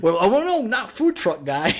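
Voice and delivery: mock shocked at the show; mock dismay. Real, not acted. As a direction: play up the shock.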